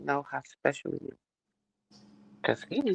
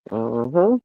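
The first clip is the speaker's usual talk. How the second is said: ordinary delivery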